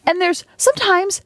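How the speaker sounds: exasperated, very high pitch; voice getting high. Real, not acted. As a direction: let the voice climb as you vent. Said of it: "Why is my voice getting so high?".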